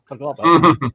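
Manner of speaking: normal talking voice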